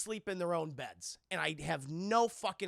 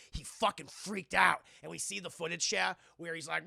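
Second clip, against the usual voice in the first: ordinary talk, nothing marked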